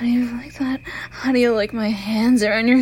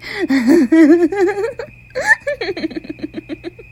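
loud, high, drawn-out cackle; villain's cackle; 6 semitones higher, 9 dB louder than usual. Acted. Killer toying with a captive, loud cackle. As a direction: let a loud, gloating laugh run on.

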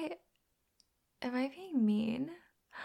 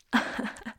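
short scornful laugh; mocking laugh. Belittling the listener, short mocking laugh. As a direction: laugh at them.

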